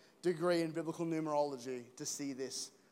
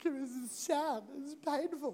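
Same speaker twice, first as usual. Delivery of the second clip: higher, slow, mock sad; hammed-up self-pity, higher and slow; mock whining; 8 semitones higher than usual. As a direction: whine it out. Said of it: "And I'm like".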